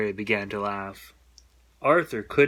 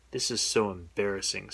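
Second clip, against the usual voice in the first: lower, softer murmur; mortified murmur; 4 semitones lower, 6 dB quieter than usual. A narrator's reading. Arthur mumbling in embarrassment to Buster. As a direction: sink into shame.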